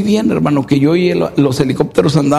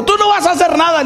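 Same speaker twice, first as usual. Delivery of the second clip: high-pitched mock mother voice; mother's retort; 13 semitones higher than usual. Son imitating his mother's scolding reply, high pitched. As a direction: voice the mother, much higher.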